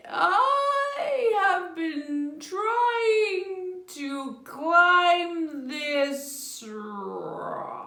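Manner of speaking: slow, wailing, sing-song turtle; drawn-out crying creature voice; weary turtle. Acted. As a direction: drag each word out like a tired, weepy turtle. Slow turtle struggling on rock.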